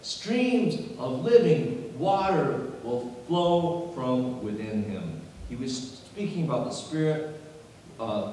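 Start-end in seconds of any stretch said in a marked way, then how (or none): 0.0-5.2 high, drawn-out preaching delivery; emphatic preaching. Acted. Preacher quoting scripture to the congregation, emphatic preaching. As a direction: proclaim the scripture with force.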